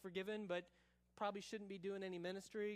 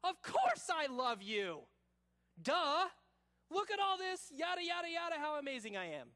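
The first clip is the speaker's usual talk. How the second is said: loud, high-pitched boasting; over-the-top know-it-all bragging; cocky, self-assured Peter; 8 semitones higher, 7 dB louder than usual. Acted. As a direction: brag it out loudly, dragging "course" with an obvious "duh". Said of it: "What would Peter have probably said?".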